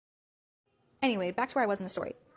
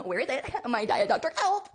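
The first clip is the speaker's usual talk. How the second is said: high, whiny cartoon wail for the lost soda; mock-distraught cartoon whine; high, laughing SpongeBob-style lament; SpongeBob moment; 6 semitones higher than usual. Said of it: "I literally had a SpongeBob moment".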